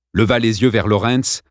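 ordinary delivery

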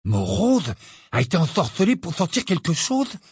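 high, incredulous rising query; astonished question. A narrator's reading. Ron hearing Morose was bewitched, astonished. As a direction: swoop up on the name in amazement.

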